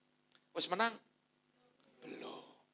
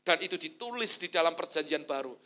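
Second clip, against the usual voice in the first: normal talking voice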